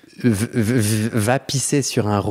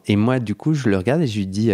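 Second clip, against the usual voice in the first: ordinary talk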